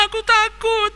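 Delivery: put-on very high wailing boy, sobbing harder; wailing child. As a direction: howl the fear out through tears. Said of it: "sambil menangis semakin keras". (anak menangis keras karena takut)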